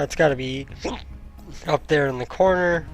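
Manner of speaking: normal talking voice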